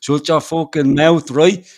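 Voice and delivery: loud, hammed-up old Dublin accent; mock-threatening old Dublin rant. Shown as a demonstration. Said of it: "talk from the old Dublin accent".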